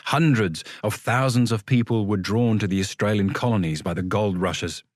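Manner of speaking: usual speaking voice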